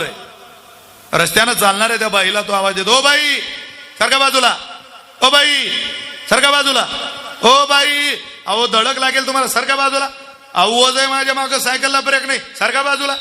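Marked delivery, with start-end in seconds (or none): 3.0-13.2 high, urgent calls to get out of the way; calling out. Retold or imitated. Cyclist with no brakes calling to the woman to move aside, urgent high shouts. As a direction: yell a warning to the woman ahead. Cyclist yells warning downhill.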